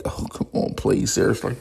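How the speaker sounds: breathy unvoiced disbelief; exasperated whisper. Reacting to the morning news, whispered exasperation. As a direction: mutter it under your breath, fed up. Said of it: "it was like".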